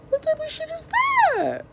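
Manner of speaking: high-pitched disbelief at the phone; exasperated squeak. Real, not acted. Mom griping at her camera hiding the time, high squeak. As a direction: squeal it in mock outrage.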